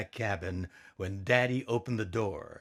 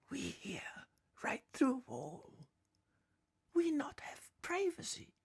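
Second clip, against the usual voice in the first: whisper; 10 dB quieter than usual. Acted. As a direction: whisper so the neighbours can't hear. Voices heard through thin wall.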